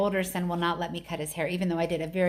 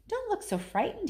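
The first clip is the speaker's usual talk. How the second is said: worried; 12 semitones higher than usual. A narrator's reading. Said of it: "he said in a worried voice".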